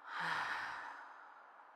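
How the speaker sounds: long, quiet exhale; contented-sounding breath out; soft sigh. Acted. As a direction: sigh it out slowly and softly. Narrator sighs within the story.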